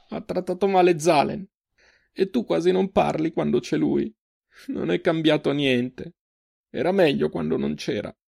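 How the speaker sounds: high, tearful confession; broken voice. A narrator's reading. Sister confiding disappointment in him to her brother, broken voice. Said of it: "la voce rotta, continuò".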